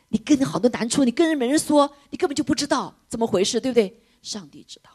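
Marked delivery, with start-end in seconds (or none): 4.2-4.9 soft whispered answer; hushed assurance. Real, not acted. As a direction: let it fall to a whisper.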